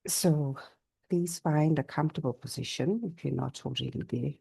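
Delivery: calm yoga nidra guidance; guided meditation. Real, not acted. Teacher settling a class into Yoga Nidra, calm guided meditation. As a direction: settle them in gently, pausing between phrases.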